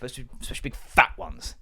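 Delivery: usual speaking voice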